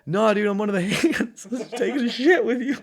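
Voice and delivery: high, loud reply broken by laughter; amused quote, giggling through the words; laughing retold confession. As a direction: own up while cracking up. Laughs through his retold answer.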